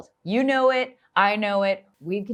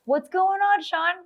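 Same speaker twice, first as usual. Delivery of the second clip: excited greeting; 11 semitones higher, 4 dB louder than usual. Acted.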